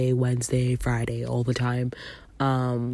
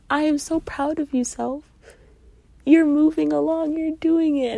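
proud self-talk; 13 semitones higher, 3 dB louder than usual. Shown as a demonstration. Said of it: "you need to say to yourself".

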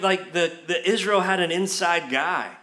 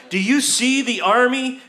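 higher, louder panicked servant; freaking out; 6 semitones higher, 5 dB louder than usual. Acted. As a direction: act out the servant's alarm. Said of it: "Gehazi is freaking out".

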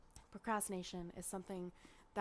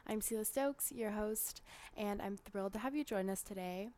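bright announcer-style host intro; podcast intro; 5 dB louder than usual. Acted. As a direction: read it like a polished show opener. Scripted podcast intro read.

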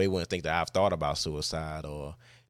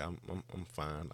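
brushing off concern under the breath; mumbled; 11 dB quieter than usual. Retold or imitated. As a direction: mumble it, stumbling over the first word. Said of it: "I mumbled".

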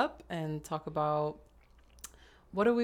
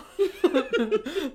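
high giggle; 10 semitones higher, 5 dB louder than usual. Real, not acted. Laugh alone.

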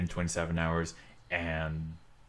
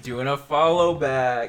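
higher, louder, slow put-on pitch; mock promo voice; 8 semitones higher, 8 dB louder, slower than usual. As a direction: sell it like a cheesy promo.

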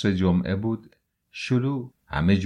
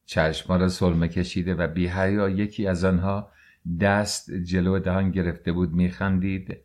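ordinary delivery, nothing marked

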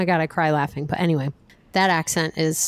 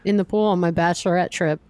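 ordinary delivery

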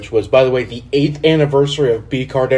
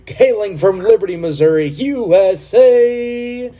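slow, high, drawn-out announcer call; boxing ring announcer; 11 semitones higher, slower than usual. Acted. Announcer introducing third member.

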